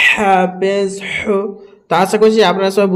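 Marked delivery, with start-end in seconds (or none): 0.1-1.4 very slow হাফেজ হু with a strong throaty h; from the throat. Shown as a demonstration. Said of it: "একদম হালাক থেকে". (হালাক থেকে টেনে হাফেজ হু)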